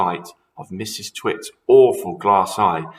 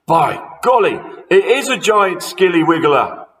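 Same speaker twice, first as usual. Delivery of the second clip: high, loud put-on amazement; mock-amazed husband; 9 semitones higher, 8 dB louder than usual. A narrator's reading. Husband pretending frog is a monster, mock amazement. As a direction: pretend to be shocked. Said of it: "Mister Twit said".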